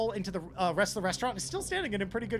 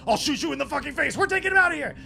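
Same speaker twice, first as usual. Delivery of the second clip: raised, high aggressive yell; threatening shout; 6 semitones higher, 7 dB louder, faster than usual. Acted. Game master voices an attacker taking the victim, threatening shout. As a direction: shout it as a violent threat, rising.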